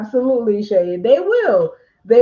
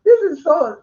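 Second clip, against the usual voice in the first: hammed-up accusing voice climbing much higher; mock accusation; 7 semitones higher than usual. Notary mocking how not to talk to a customer, high, incredulous. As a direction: scoff and interrogate, pitch rising. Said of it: "Don't be like".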